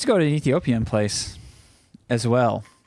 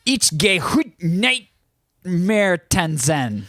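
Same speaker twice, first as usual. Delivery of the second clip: a high, slow try at German; German attempt; 6 semitones higher, much slower than usual. Shown as a demonstration. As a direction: ich gehe heut nicht mehr tanzen said high and drawn-out. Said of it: "give it your shot".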